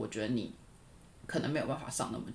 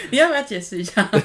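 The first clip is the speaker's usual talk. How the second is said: laughing; 6 semitones higher, 13 dB louder than usual. Real, not acted. Laughing teasing her husband.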